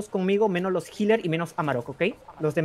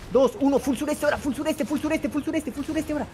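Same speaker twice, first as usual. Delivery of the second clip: urgent engage call; 8 semitones higher than usual. Real, not acted. Shotcaller calling the engage.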